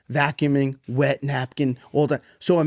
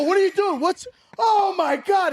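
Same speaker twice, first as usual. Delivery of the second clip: much higher, slowing on oh my God; dad finding his son mopping a flood with one paper towel, shocked; retold shocked yell. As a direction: gape at the flood in disbelief.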